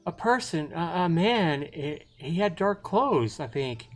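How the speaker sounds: put-on high, stammering, shaken girl; flustered. Shaken girl reports the stranger.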